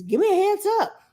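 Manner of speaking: put-on high, far child voice; imitating a child, mock high; child's demand. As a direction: pitch it up like a kid asking.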